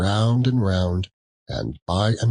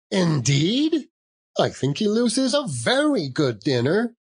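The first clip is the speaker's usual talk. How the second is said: put-on higher, eager interruption from the young wife; the young wife cutting in to defend the dinner, pitched up; niece interrupting; 10 semitones higher than usual. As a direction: cut in brightly, a little higher. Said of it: "interrupted Scrooge's niece".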